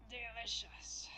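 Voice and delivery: creepy whispered voice. Acted. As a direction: breathe it out like a creep. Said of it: "I need to creep your voice for him".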